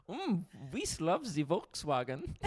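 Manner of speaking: slow, hammed-up German accent; badly faked German, drawn out. Shown as a demonstration. Host still doing the requested German, in a slow mock German accent. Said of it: "All right, German".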